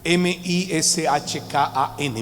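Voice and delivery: M I S H K A N spelled out slowly, one letter at a time; slow letter-by-letter spelling of Mishkan. Shown as a demonstration. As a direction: spell out M I S H K A N for the class. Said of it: "viene del hebreo Mishkan".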